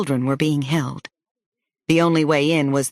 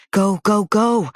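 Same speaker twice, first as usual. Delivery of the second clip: urgent shout; 5 semitones higher, 3 dB louder, slower than usual. Acted. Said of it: "I pushed and shouted behind the kids".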